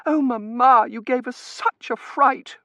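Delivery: put-on high, loud girlish voice; fretful daughter. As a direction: gush it, high and slowing. Daughter relieved after mother's faint.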